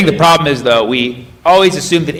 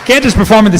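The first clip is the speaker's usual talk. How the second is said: mock announcement; 10 semitones higher than usual. Comedian joking about his next tour, hammed-up high. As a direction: pitch way up like a hype promo.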